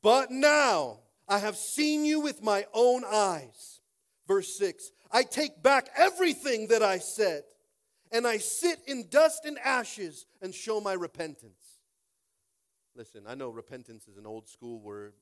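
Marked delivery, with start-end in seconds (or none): 0.0-11.8 loud, slow scripture reading. Acted. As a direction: read Job's words louder and slower. Preaching Job's words loudly.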